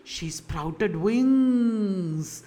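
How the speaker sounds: very slow, stretched-out delivery; dramatic drawn-out reveal. Real, not acted. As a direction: draw out "wings" for wonder. Dramatic reveal in retold plot.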